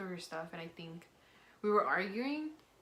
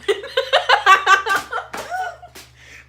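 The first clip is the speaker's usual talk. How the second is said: loud laugh burst; 23 dB louder than usual. Real, not acted.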